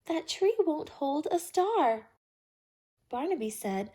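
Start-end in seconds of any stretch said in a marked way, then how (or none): 0.1-2.1 higher, louder call from afar; little bear calling from a distance. A narrator's reading. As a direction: call across to the others. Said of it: "cried out".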